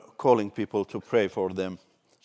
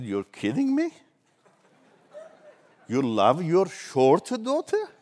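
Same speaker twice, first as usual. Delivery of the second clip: higher, playful mock incredulity; teasing disbelief; 7 semitones higher than usual. Pastor playfully doubting the lady loves her daughter, teasing. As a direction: pretend to be stunned, tongue in cheek.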